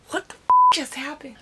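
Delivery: high, loud shriek of disbelief; a sudden shocked squeal; shocked outburst. Real, not acted. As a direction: blurt it out in shock. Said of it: "almost just had a panic attack".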